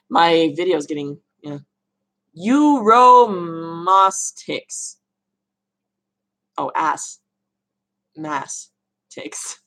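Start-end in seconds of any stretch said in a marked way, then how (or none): 2.4-5.0 Euromastix extremely slowly, syllable by syllable, lingering on the M; sounding it out. Shown as a demonstration. Sounds out the difficult name. 6.6-9.6 ass, then Mass, then Ticks, each alone with pauses; separated syllables. Shown as a demonstration. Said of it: "I have to say ass separately".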